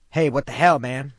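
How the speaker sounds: sudden loud yell. A narrator's reading. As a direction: shout it out at him. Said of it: "I yelled, loud enough for everyone".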